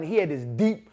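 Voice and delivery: ordinary talk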